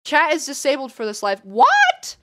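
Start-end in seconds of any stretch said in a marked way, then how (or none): 1.4-2.2 very high, loud squeal; shocked disbelief. Real, not acted.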